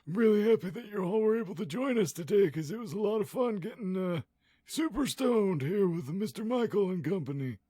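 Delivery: high, strained held-breath voice; stoned voice. Pretend. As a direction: talk while holding smoke in. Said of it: "this is my stoned voice".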